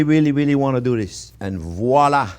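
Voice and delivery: ordinary talk, nothing marked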